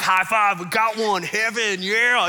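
loud, high mock celebration; exaggerated cheer over a win; cheering churchgoers. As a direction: whoop it up like a hammed-up congregation. Acting out the cheering peekers.

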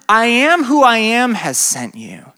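ordinary talk, nothing marked

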